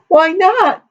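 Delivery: very high, plaintive question; tearful wail. A narrator's reading. Daughter asks why he won't marry her, wailing. Weeping Amity answers being rejected.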